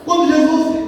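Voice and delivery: high-pitched, ringing sermon delivery; impassioned preaching. Real, not acted. Preacher questioning the church about Peter's love, impassioned preaching.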